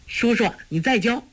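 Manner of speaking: put-on high, eager little boy's voice; a child begging for another round; happy child. A narrator's reading. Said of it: "托雷喜道".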